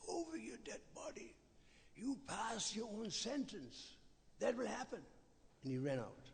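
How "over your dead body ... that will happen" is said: high, stunned echo of the words; the uncle recoiling at defiance, shocked; shocked disbelief. Retold or imitated. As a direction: repeat it in outraged surprise. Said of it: "He was shocked".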